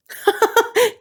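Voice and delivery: very high cackle; gleeful wicked laugh; witch cackle. Acted. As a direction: witch enjoying the cruel joke.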